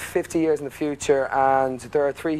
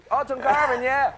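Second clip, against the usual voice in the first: mock agreement; 11 semitones higher than usual. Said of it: "Thank God I didn't go".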